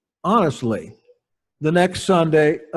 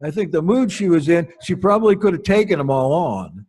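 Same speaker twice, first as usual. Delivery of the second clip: ordinary talk, nothing marked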